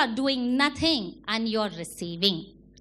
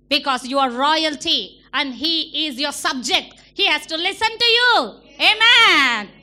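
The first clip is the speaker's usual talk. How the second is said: louder, rising preacher's command; full-force preaching; 2 semitones higher, 7 dB louder than usual. Acted. Preacher commands the congregation to rebuke Satan, loudly. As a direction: drive it home to the crowd.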